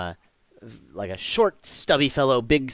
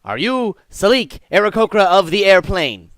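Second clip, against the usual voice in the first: raised, loud courtroom voice; booming judge; 7 semitones higher, 8 dB louder than usual. Acted. Judge confirming the accused's name, loud official voice. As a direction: formally demand it from the bench.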